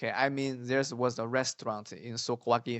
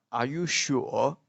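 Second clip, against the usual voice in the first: "Are you sure?" slow, lifting on "sure"; British questioning tune; 4 dB louder, slower than usual. Shown as a demonstration. Teacher imitates a British waiter, slow questioning tune. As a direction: stretch "sure" into a doubtful question. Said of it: "a British way of saying this".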